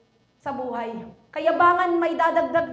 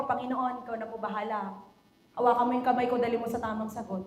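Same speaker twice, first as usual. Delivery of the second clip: soft, lower pleading prayer; quiet prayer; 5 semitones lower, 5 dB quieter, faster than usual. Retold or imitated. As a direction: pray humbly, almost under the breath. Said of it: "sabi ko". (mahina at mapagpakumbabang dalangin)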